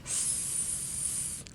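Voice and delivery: long airy sss on its own; hissed S. Shown as a demonstration. Teacher demonstrating the S in scared, long airy hiss. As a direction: push air between the teeth in a long S. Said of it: "in between my teeth when I say the S".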